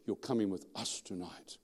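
lightly voiced demon, hushed; possessed man's voice. Demon speaking through a village girl to its target, quietly menacing. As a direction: drop the volume for a quiet threat. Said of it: "out of her voice came a man's voice".

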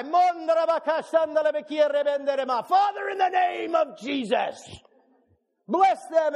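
high, loud, slow shouted prayer; fervent shouted blessing over the room. Retold or imitated. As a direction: pray at the top of your lungs. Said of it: "I let it fly".

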